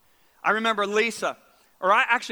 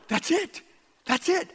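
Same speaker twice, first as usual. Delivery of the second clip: high but held-in exclamation; contained, high-pitched thrill of discovery; retold inner excitement; 7 semitones higher, slower than usual. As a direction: squeak it excitedly without shouting. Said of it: "inside I'm going".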